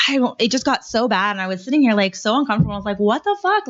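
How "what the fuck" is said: a high, stunned "what the fuck"; pitched-up disbelief; appalled exclamation. Retold or imitated. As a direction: jump up in outrage. Disgust at the customers' talk.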